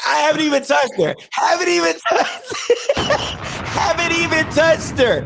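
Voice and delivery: indignant. Real, not acted. Telling friend the crying was fake, incredulous. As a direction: laugh through disbelief.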